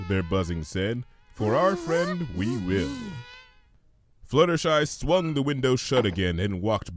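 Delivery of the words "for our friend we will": slow, solemn little chorus voice; bees' answer. Acted. Bees promising Fluttershy, slow and earnest. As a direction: make it a slow earnest pledge.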